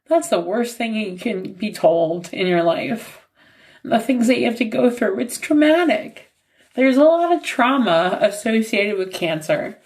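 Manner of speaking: normal talking voice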